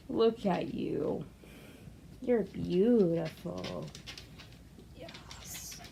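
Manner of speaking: gently adore the cat; soft pet praise. Real, not acted. Owner softly praising her cat, slow and soft.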